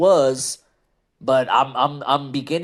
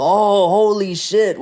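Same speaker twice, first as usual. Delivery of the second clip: mock shocked gasp, higher, louder and slowed; 7 semitones higher, 6 dB louder than usual. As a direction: ham up a fake jaw-drop for the crowd. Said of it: "was that supposed to make us gasp".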